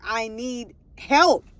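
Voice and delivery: drawn-out cry for help; retold plea. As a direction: stretch it out, pleading, in a retelling.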